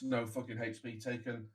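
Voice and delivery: ordinary talk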